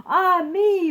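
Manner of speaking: high, loud gasp of wonder; king marveling at his throne; delighted gasp. Acted. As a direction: gasp in awe.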